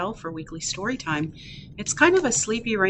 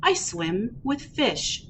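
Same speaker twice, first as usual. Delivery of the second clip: happy duckling, picture-book reading. Acted. Ducky off to play in the rain, happy read-aloud. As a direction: read playfully to toddlers, bouncing the quacks.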